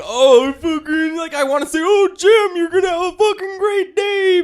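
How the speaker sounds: very high, loud, sing-song fake cheer; mock fake cheerfulness. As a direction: squeal it like a forced cheerful greeter. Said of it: "just sit there and be like".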